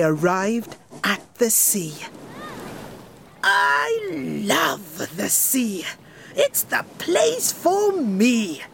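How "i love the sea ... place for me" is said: high, slow dreamy king voice; delighted king. Acted. King Jolly arrives at the sea, high slow delight. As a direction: savour every word like a happy king. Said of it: "said King Jolly".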